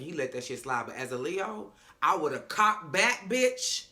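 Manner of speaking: ordinary delivery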